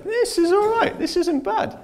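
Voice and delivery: pleased with a clever trick; high, mock delighted; smug delight. Pleased with himself.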